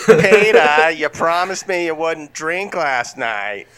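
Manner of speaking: loud opening call, then slow drawn-out scolding; hammed-up nagging wife impression; Fran Drescher. Lois scolds Peter for drinking, in a slow hammed-up impression. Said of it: "recast Fran Drescher as Lois".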